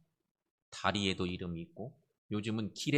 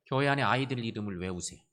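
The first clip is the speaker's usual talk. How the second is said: normal talking voice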